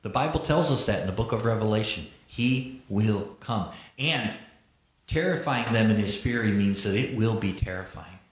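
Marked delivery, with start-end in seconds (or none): 2.3-3.7 slow and grave. Shown as a demonstration. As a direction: let "He will come" fall slow and heavy. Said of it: "underline the word will".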